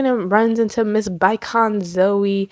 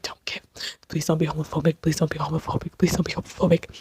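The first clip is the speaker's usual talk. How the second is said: low, soft mock fearful pleading; scared pleading; 7 semitones lower, 8 dB quieter, much faster than usual. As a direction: beg under your breath, scared. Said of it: "I was scared for my life".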